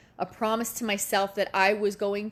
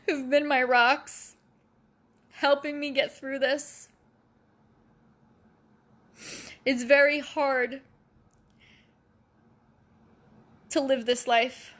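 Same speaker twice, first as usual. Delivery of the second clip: choked up and fighting it while thanking friends; getting emotional; 5 semitones higher than usual. Real, not acted. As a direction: voice catching with real emotion. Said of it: "Now I'm gonna get emotional".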